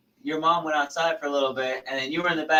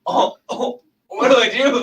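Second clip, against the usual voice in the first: mock panic; 6 semitones higher, 7 dB louder, slower than usual. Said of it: "and I was like".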